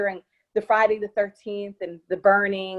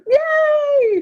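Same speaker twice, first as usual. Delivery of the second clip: high loud squeal of joy; cheering; 7 dB louder than usual. Retold or imitated. Actress retelling the cast's joy at the release, high loud cheer. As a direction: celebrate like a kid. Film finally released, cast celebrates.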